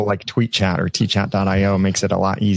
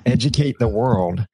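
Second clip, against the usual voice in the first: ordinary talk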